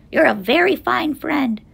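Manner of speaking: high, grateful little voice; tiny speck voice. A narrator's reading. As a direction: pipe up from a dust speck. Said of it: "came the voice".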